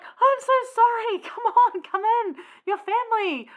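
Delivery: high, loud, gushing apology and welcome; so happy. Retold or imitated. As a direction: act out an overjoyed host beckoning family in. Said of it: "he gets so happy".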